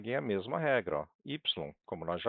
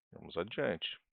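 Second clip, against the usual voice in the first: ordinary delivery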